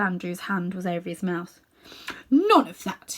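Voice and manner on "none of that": hissed. Acted. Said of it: "he hissed in Diggory's ear".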